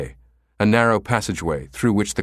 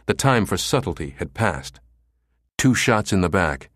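usual speaking voice

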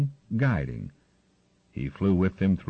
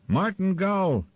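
high, drawn-out call; raised, carrying call with stretched words; shouting across the sky; 11 semitones higher, slower than usual. A narrator's reading. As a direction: call out to someone far off in the sky. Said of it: "he shouted across the sky".

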